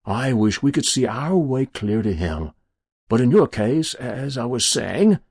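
smooth, relishing collector's patter; smug stranger. Acted. Stranger appraising souls for his collection, smug. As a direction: savour the thought of the prize.

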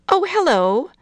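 high, bright neighbourly greeting; lady voice, chirpy welcome; friendly hello. A narrator's reading.

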